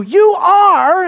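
high, loud YOU ARE as an accusation; shouted declaration. Acted. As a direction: shout it as a statement. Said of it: "now standing and shouting".

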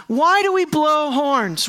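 ordinary delivery

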